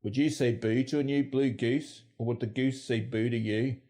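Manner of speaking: Northern Irish accent. Shown as a demonstration. Presenter showing regional vowels, in Northern Irish. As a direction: say it as in Northern Ireland. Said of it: "And Northern Ireland".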